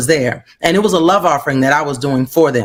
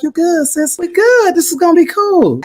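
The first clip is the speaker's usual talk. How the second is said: much higher, bright upbeat pep; retold hype reassurance; 13 semitones higher than usual. Reassuring a nervous new friend, high cheerful pep. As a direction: gee her up, easy and high.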